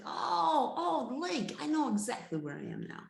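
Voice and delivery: high, loud burst of relief; excited relief. Acted. Lost hunter spotting the lake, relieved and excited. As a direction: blurt it out, suddenly recognising the place.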